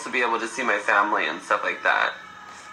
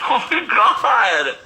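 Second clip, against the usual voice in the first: slow, drawn out through laughter; laughing; 9 semitones higher, 5 dB louder, much slower than usual. Real, not acted. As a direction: gasp it while laughing. Still laughing.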